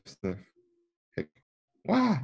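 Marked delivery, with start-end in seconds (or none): 1.8-2.2 sudden high wah; startled wah. Acted. Reader startled by a noise: high cry. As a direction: yelp in fright.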